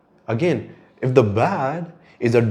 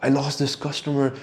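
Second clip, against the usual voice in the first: imitating angry client; 5 semitones higher than usual. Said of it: "he was pissed off".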